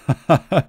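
mocking giggle; laughing at his own joke; brief teasing laugh; short chuckle. A narrator's reading. Masked man laughing.

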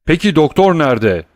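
loud demanding shout. Acted. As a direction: demand where the doctor is. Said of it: "diye bağırdı Villefort". (Villefort'un yüksek sesle bağırışı)

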